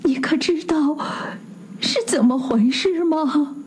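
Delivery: high, hushed, wavering question; trembling voice. A narrator's reading. Husband asks his friend about his wife, trembling. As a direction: ask it as if afraid of the answer. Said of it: "伯罗姆声音发抖了".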